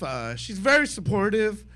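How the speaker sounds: normal talking voice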